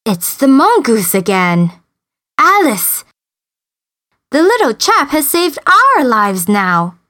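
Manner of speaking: lightly voiced grateful man; relieved big man. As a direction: call out in relief to his wife. Big man's relieved exclamation.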